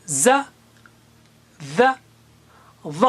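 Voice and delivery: ز، ذ، ظ said one by one, ظ heavier; teacher contrasting three Arabic letters. Shown as a demonstration. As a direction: set ز، ذ، ظ apart with pauses. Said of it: "جیسے یہ پہلا set ہے".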